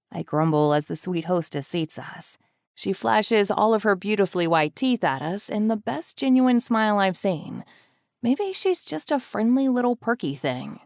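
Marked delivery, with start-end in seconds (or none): none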